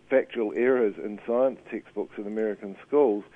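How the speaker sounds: normal talking voice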